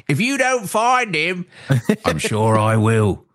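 slow, high Cockney Loomis line; Cockney accent. Retold or imitated. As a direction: play it broad Cockney. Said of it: "all of a sudden that accent".

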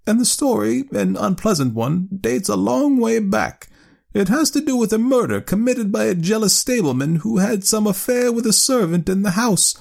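high-pitched spinster aunt, telling a tale; earnest elderly aunt. Acted. Aunt telling her nephew of the haunted house, earnestly. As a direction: voice an excitable old aunt, high.

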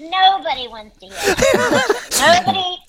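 laughing protest. Real, not acted. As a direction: laugh while refusing. Laughing at the low voice idea.

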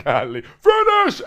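high squeaky mocking Finish; mock coach yell. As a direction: whine Finish back in a high voice. Mimicking coaches yelling finish.

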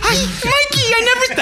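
squeaky-high woman's voice; mock wife imitation. Man imitating his sister-in-law on the phone, absurdly high mock voice. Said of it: "your imitation of my wife".

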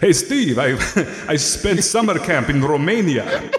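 slow, hammed-up Romanian vampire accent; mock Romanian accent. Podcaster as ghost greets Steve after summer camp, mock Romanian accent. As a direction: fake Romanian accent, cracking up midway. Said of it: "you pick up the accent".

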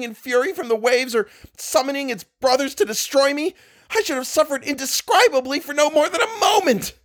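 high, strained and rising toward a scream; rising shrill voice. Acted. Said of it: "voice had risen to a shrill scream".

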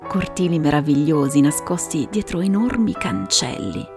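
usual speaking voice

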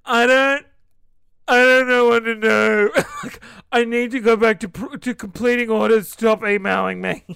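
loud, high, laughing outburst; mock shout. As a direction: yell it in hammed-up despair. Mock outburst at customer emails.

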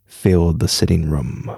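low, even storytelling voice; story narration. A narrator's reading. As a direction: read it like a spooky tale. Reads a ghost story aloud.

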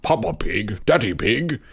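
lightly voiced cartoon pig dad; a hint of a cartoon father pig; Daddy Pig. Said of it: "doing the voice of".

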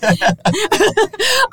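loud, high burst of laughter; delighted loud cackle; real laugh. Real, not acted. Amused by sassy guest.

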